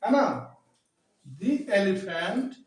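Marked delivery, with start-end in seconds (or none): 1.2-2.5 slow dictation. Shown as a demonstration. Teacher dictating the second sentence slowly. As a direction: dictate it word by word. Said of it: "तो कहेंगे".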